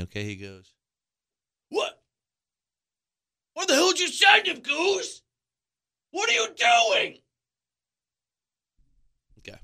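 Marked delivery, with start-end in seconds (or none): 1.7-7.2 mock manager's yell. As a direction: yell at the first base coach like an outraged manager. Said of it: "this is what he did to me".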